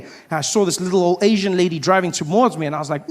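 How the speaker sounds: ordinary talk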